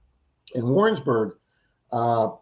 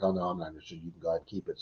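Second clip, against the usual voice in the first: soft polite refusal; 8 semitones lower, 13 dB quieter, faster than usual. Retold or imitated. Homeowner turns down the bracelet, soft and low. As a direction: lower and much softer, politely declining. Lady voiced declining the bracelet.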